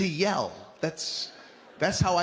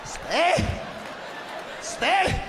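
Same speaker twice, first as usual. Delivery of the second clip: stern parents; 10 semitones higher than usual. Retold or imitated. Parents holding him down.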